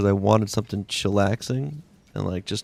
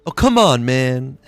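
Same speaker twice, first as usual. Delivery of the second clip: imitating his son; 2 semitones higher, 6 dB louder, slower than usual. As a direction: toss it off like his young son. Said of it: "You know what my son loves to say now?".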